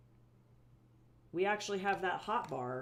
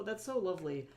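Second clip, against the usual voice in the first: ordinary talk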